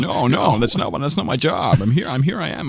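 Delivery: high-pitched, stammering film-star voice; hint of a flustered old-movie American; Jimmy Stewart impression. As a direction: keep up the halting screen-idol voice.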